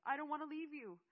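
put-on high, loud child's plea; desperate protest. A narrator's reading. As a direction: cry out against being pulled away. Seth fighting to stay with narrator.

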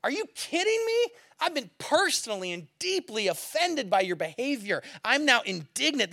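high, loud mock outrage; hammed-up offended protest; indignant. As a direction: act personally affronted, voice shooting up in disbelief. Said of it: "indignant is like".

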